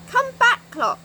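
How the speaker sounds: high, loud call after the clock; a loud high call to a wandering clock. Acted. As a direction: call it back across the beach. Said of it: "called Silky".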